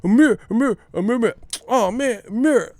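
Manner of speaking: high, loud bursts of failed attempts; Tim Bradley, struggling stutter. Imitating a commentator stuck on Ramirez, frustrated stutter. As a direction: stammer in frustration on the same syllables. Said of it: "struggling so bad to say Ramirez".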